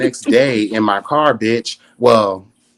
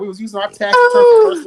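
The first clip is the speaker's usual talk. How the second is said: excited; 5 dB louder than usual. Real, not acted. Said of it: "let's just tell the truth".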